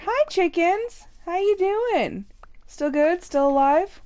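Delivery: high sing-song hello; sweet greeting to the chickens; high, slow pet greeting. Real, not acted.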